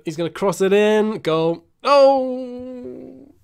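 loud, rising to a long high "oh"; alarmed commentary. Real, not acted. Gamer watches the cross become a goal, loud dismayed commentary. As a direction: raise the voice as the cross comes, then wail.

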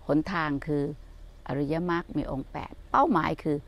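soft, low, unhurried teaching voice; a quiet reflective sermon; calm dharma talk. Acted. Dharma reflection before the tour. (เทศน์สอนธรรมด้วยน้ำเสียงนุ่มเบาและสงบ)

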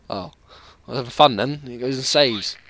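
normal talking voice

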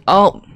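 high, loud cry of shock; sudden real yelp. Real, not acted. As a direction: blurt a sharp startled "oh". Game character suddenly dies.

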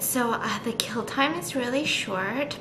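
breathless whisper. Real, not acted. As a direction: keep it secret, whisper. Secret imposter whispering.

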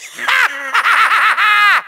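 gloating villainous cackle; rattling, theatrical villain laugh; evil laugh. Acted. Villain mocks the shocked Skylander, evil cackle.

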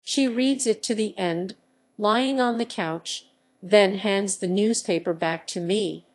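ordinary delivery, nothing marked

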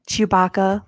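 normal talking voice

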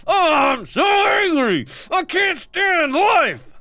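high, drawn-out exasperated shouting; angriest. Real, not acted. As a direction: wail in comic rage, stretching every word. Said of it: "this is me at my angriest".

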